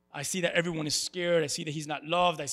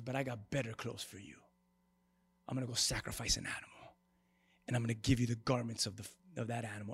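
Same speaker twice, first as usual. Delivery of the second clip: tender God; 11 semitones lower, 11 dB quieter, faster than usual. Retold or imitated. God voiced in retold story.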